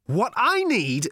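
high, loud, slow shout. Acted. Ford clarifying to Arthur, slow loud shout. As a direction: spell it out at full volume. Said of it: "shouted Ford".

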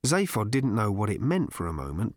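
ordinary delivery

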